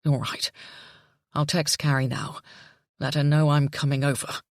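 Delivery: a father on the verge of panic; shaky. A narrator's reading. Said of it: "his voice shaky".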